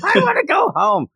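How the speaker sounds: put-on high squeaky little kid voice; whiny kid. Recapping a show, voicing a kid who wants to leave, high whine. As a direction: whine it like a tired small child. Kid whining in retold scene.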